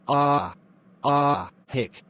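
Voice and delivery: ah, ah cut off by a hiccup; saying ah. Acted.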